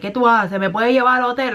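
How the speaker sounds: put-on Puerto Rican accent. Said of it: "the Puerto Rican or whatever accent".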